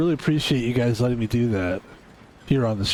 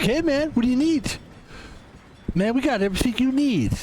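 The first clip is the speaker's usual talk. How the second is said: high, laid-back dude voice; capture card voice; 9 semitones higher than usual. Pretend. The streamer voices his capture card, high laid-back dude. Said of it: "that's how my capture card talks".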